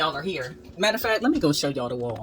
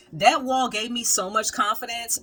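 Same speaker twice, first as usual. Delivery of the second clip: ordinary talk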